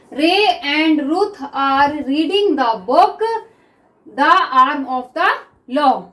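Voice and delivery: very slow, word by word with pauses; slow dictation. Shown as a demonstration. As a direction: dictate each word so students can write. Reads the sentence aloud slowly.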